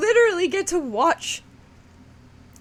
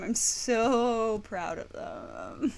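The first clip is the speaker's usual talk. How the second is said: slow, soft and tearful; choked-up pride; 4 semitones lower, 6 dB quieter, much slower than usual. Real, not acted. Streamer proud of friends, choked up. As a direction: draw out "so", close to tears. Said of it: "I'm trying not to cry".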